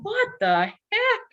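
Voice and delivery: high startled squeak of disbelief. Retold or imitated. Man floating above the street, high startled disbelief. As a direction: shoot way up in pitch, stunned. Realizing feet off the ground.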